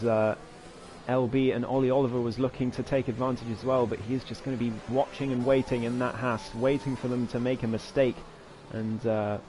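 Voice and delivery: ordinary talk, nothing marked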